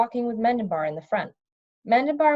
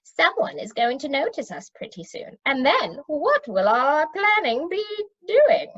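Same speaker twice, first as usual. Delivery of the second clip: fretful underling pleading; nervous wizard climbing to a yelp; anxious wizard; 6 semitones higher than usual. Acted. Worried wizard voiced.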